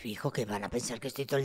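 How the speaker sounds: low, unvoiced aside; whispered reply. Real, not acted. Mother answering her son's confession, whispered. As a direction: answer him in a hush.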